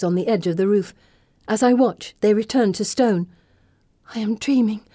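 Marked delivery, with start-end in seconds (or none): none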